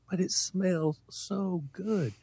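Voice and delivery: put-on slow, high, soft kid's protest; child pleading. Kid defending gas sniffing to mom, pleading. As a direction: plead dreamily like a child, drawn out.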